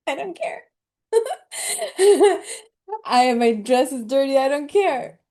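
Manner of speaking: real laughter breaking into the words; laughing through tears. Real, not acted. Speaker laughs at her lashes falling off, laughing.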